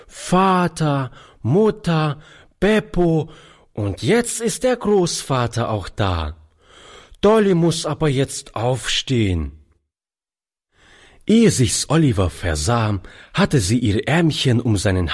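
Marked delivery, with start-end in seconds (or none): 0.1-9.5 lightly voiced little girl, higher at first; child counting. A narrator's reading. Little girl counts her family on her fingers, lightly childlike. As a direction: count off like a small child, item by item. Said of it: "zählte an ihren Fingern".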